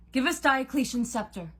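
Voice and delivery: high, loud impatient order; forceful demand. A narrator's reading. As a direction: demand it from an enemy. Nico demands the scepter from Cupid.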